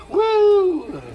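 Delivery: a high whooping cheer, hammed up; mock crowd woo. Mocking the mob's cheer, a high hammed-up whoop. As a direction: imitate the crowd cheering back at the knight. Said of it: "with a return of".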